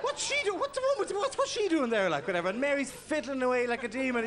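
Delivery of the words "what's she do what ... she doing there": put-on squeaky indignant child voice, raised high; a ten-year-old furious at the telly; shrill-free high-pitched outraged kid. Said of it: "every ten-year-old in the country was going".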